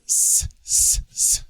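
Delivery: sharp, loud "sss" hisses; demonstrated sibilant hiss; hissing sibilance. Shown as a demonstration. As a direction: hiss the letter S like a snake. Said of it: "Hear all the hissing".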